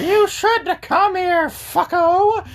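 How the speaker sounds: very high, drawn-out eerie falsetto; mock sheeted killers. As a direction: threaten in a silly ghostly falsetto.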